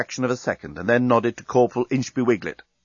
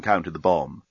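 ordinary delivery, nothing marked

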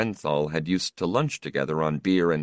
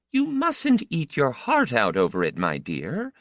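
higher worried mother voice; mother's voice; 8 semitones higher than usual. A narrator's reading. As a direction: voice the anxious mother.

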